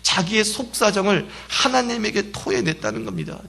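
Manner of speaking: ordinary delivery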